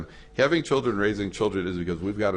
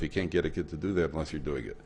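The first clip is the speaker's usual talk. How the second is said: ordinary talk, nothing marked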